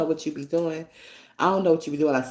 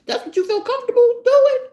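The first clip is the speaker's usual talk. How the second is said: much higher, louder mocking jab; scornful mockery; 4 dB louder than usual. Real, not acted. Mother mocking the son's girlfriend, high and scornful. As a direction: throw it back at her with scorn.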